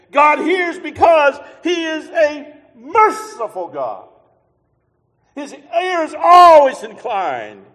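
loud, high, slow preacher's call; full-force preaching. Acted. Preacher shouts God's mercy to the congregation, loud and slow. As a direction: thunder it out from the pulpit.

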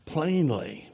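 normal talking voice

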